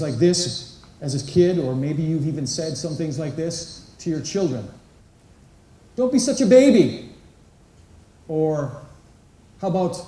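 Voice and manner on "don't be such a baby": higher and louder, a snapping parent; mock parent scold. Parent scolding a crying child, sharp and loud. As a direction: scold the kid like an impatient dad.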